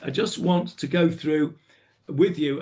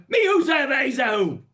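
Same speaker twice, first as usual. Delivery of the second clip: higher and louder, shouted demand; angry king; 9 semitones higher, 3 dB louder than usual. Shown as a demonstration. Achashverosh demanding to know the culprit, angry. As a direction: bellow the question in royal fury. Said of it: "You're really angry".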